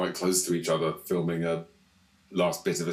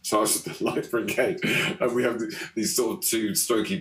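usual speaking voice

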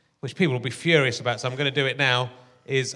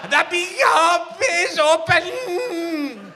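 very high, very slow, shouted; TV lady impression; 13 semitones higher, 4 dB louder, much slower than usual. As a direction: draw it out high and loud. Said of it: "have you done your Scottish accent yet".

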